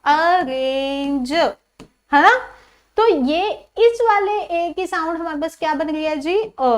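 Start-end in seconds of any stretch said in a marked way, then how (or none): 0.0-1.3 अरेंज said very slowly, each sound drawn out; pronunciation demo. Shown as a demonstration. Teacher slowly modelling अरेंज for her students. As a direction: stretch अरेंज out slowly so every sound is clear. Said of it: "इस वाले ए में मैं क्या बोल रही हूँ इसको".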